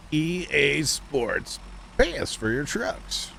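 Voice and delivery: slow, drawn-out parody tagline; mock slogan voice. Streamer mocking pay-to-win trucks, slow slogan parody. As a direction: announce it like a spoof game ad.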